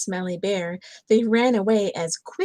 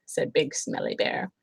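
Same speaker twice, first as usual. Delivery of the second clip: ordinary delivery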